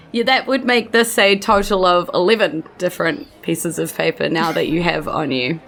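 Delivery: ordinary talk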